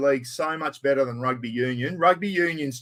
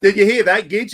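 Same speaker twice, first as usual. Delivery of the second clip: louder, calling across the room; 4 semitones higher, 8 dB louder than usual. Real, not acted. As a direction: call out to a mate nearby. Calling out to Gidge off-camera.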